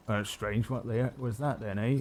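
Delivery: put-on quiet, puzzled old farmer; a lightly voiced confused old farmer; old farmer muttering. GM voicing an old farmer to himself, puzzled and quiet. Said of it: "as he starts moving along".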